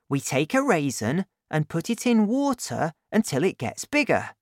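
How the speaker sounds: put-on excited boy laying out his plan; lightly voiced boy, thrilled with his idea; young Danny.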